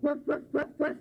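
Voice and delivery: high yappy woof woof; dog barking. Pretend. Child barks for the dog in the story. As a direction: bark like a little dog. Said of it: "the dog would bark".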